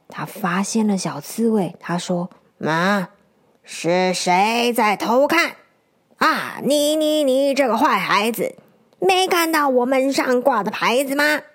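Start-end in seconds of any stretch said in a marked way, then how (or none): 2.6-11.4 put-on cranky old grandma lizard; grumpy old lizard granny. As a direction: catch the snoop and tell him off.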